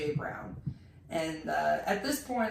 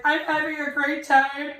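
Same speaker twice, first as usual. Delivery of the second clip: higher, louder heartfelt declaration; emotional outburst; 7 semitones higher, 7 dB louder than usual. Retold or imitated. [name] declaring his love for the night, loud and emotional. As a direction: gush it out, overwhelmed with joy. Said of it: "I really just was like".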